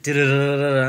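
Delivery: mock game humming. Mocking his game-playing noises.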